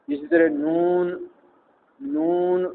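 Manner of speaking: नून with a nasal vowel; wrong nasal version. Shown as a demonstration. Teacher demonstrating the wrong nasal नून. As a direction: push नून through the nose. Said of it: "वाव की आवाज़ नाक में जा रही है".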